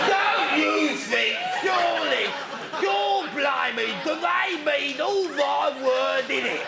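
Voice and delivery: loud, high, drawn-out Cockney shouting; Derek Jameson. Acted. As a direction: bellow a grotesque Cockney caricature, slowly. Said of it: "Derek bloody Jameson. The mo-, the most famous cock".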